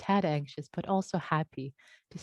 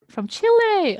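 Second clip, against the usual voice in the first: high, loud, thrilled repeat; light up at the name Chile; excited exclamation; delighted; 14 semitones higher, 9 dB louder than usual. Real, not acted.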